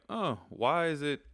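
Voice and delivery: mock the student's confusion; puzzled student voice. Teacher imitates a puzzled student asking about spelling. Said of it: "So I know you're like".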